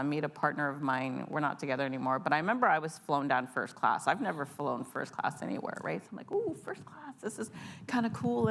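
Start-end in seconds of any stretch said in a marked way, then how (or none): 6.3-8.5 giddy inner voice. Retold or imitated. Excited inner voice on first flight.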